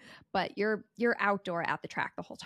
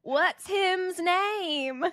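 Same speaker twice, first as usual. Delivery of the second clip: put-on high, drawn-out little boy's Southern accent; 8 semitones higher, 4 dB louder, much slower than usual. Little boy pointing at the baby to ask, Southern accent. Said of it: "in this little Southern accent".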